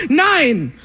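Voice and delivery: ordinary talk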